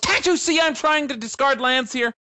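mock busy boss. Phone skit as Mister Rigmos.

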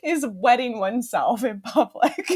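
words breaking up with laughter; laughing admission. Real, not acted. Podcaster admitting an embarrassing topic to co-host, laughing. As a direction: struggle to get it out through giggles.

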